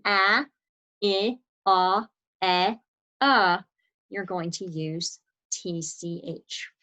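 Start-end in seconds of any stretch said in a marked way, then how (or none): 0.1-3.6 a, I, ah, eh, uh as separate short vowels; short vowel sounds. Shown as a demonstration. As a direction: sound out a, I, ah, eh, uh one by one, as in cat, sit, hot, bed, cup. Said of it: "right after a short vowel".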